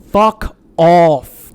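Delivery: loud, harsh fuck off; loud scornful brush-off; angry dismissal. Real, not acted. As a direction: spit it out at her. Angry outburst at ex's excuses.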